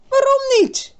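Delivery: almost shout it at him; girl nearly shouting at Marijn. A narrator's reading. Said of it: "Birgit schreeuwde bijna".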